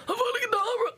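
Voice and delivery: high, hammed-up villain cry; Voldemort curse. Friend imitating Voldemort casting the curse, hammed up. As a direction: cast it like a movie villain. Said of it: "Voldemort, like".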